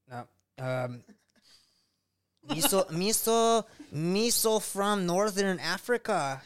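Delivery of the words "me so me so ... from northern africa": slow, higher-pitched attempt at a Northern African accent; hammed-up Northern African accent, words stretched; badly faked Northern African accent. Shown as a demonstration. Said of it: "like, Northern African accent".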